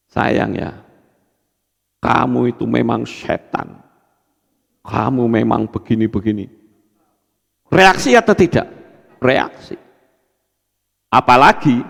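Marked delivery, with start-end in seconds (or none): 0.1-6.5 soft, sweet-sounding insult; honeyed softness hiding a curse; gentle tone. Shown as a demonstration. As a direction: say the cruel words tenderly, almost hushed. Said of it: "dengan nada lembut saja".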